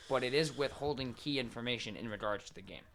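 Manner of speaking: ordinary talk, nothing marked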